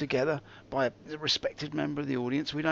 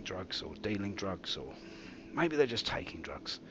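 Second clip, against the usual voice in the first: whispering; 7 semitones lower, 5 dB quieter than usual. Acted. Continued conspiratorial aside.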